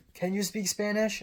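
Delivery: put-on high-pitched Cantonese-accented question; Cantonese English accent. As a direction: imitate an old woman's Cantonese-English. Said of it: "that's a Cantonese English accent".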